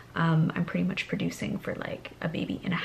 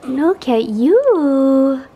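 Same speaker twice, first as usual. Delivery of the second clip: slow tender coo; 6 semitones higher, 12 dB louder, much slower than usual. Real, not acted. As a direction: linger over the words, cooing. Cooing at baby.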